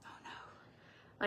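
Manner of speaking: breathy unvoiced whisper of dismay. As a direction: whisper it like a quiet groan of panic. Dismayed at drive-thru Spanish.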